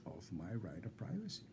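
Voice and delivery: fading to a soft whisper; whispering the end to the doctor. Real, not acted. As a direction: drop almost to breath on privacy. Trails off while lecturing the doctor.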